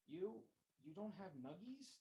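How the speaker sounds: put-on high, slow whiny child; a little girl about to cry; wailing little girl. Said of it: "the little girl wailed".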